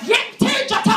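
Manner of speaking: high-pitched angry mother yell; mock furious street scolding. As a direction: imitate a mother screeching at her child. Mother yelling at child in street.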